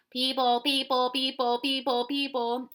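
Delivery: loud, repeated, slowing at the end; screaming. Acted. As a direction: chant it as a loud alarm. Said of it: "they were screaming".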